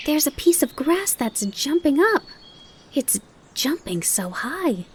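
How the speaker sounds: put-on high, wondering child voice; excited. Fawn tells his mother, high and amazed. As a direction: marvel at it with wide eyes. Bambi marvels at grasshopper.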